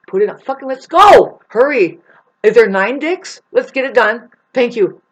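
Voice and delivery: loud, high, eager mock rush; mocking boys shouting to hurry up; hyped-up greedy teens. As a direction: ham up a greedy kid who can't wait for the money. Teenage boys cashing in.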